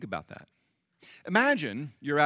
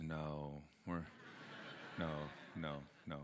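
much softer, mumbled mock refusal; son's sheepish no; 8 semitones lower, 16 dB quieter than usual. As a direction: imitate the son backing down, very soft. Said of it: "And he goes".

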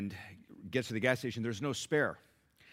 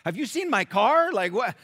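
much higher, much louder disbelieving yell; incredulous shout; 11 semitones higher, 10 dB louder than usual. Retold or imitated. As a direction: blurt it out in total disbelief. Bob calling pastor, car gone.

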